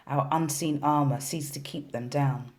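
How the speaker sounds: ordinary delivery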